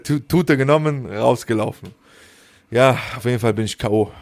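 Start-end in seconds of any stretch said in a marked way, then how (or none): none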